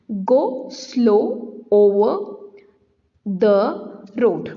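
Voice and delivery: very slow, drawing out each o; slow example read-out. Shown as a demonstration. Teacher showing students the repeated o, very slow. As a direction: stretch the o sounds slowly. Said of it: "यहाँ पे ओ".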